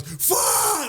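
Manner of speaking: retold drawn-out scream; mock a customer screaming in agony; blood-curdling yell. Customer screaming at the drive-thru message, blood-curdling. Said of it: "he just yells".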